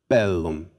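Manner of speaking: bellum as BEL-lum; first-syllable stress. Shown as a demonstration. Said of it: "First syllable".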